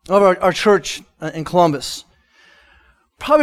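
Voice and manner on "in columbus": ordinary talk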